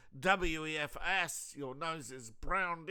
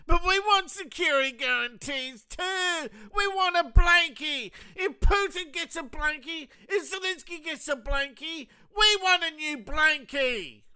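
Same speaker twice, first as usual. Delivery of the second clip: put-on high, loud childish whining; whiny Europe; 13 semitones higher, 10 dB louder than usual. Europe demanding guarantees, high loud childish whine. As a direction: whine like a toddler for a blanket. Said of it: "Europe is going".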